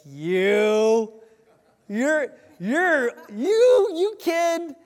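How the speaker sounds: high, loud, almost crying with glee; can't hold in the good news; bursting with excitement. Retold or imitated.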